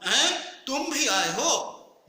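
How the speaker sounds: high, loud, slow astonished "हैं"; astonished at an unexpected guest; surprised exclamation. Shown as a demonstration. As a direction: sound truly amazed he came. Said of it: "तो क्या बोलता हूँ".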